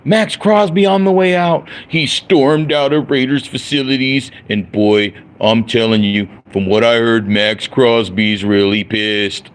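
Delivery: high, slow mocking hype-video voice; slow, high mock clickbait narrator; mocking clickbait YouTuber. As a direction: ham up a breathless clickbait host. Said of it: "making videos like".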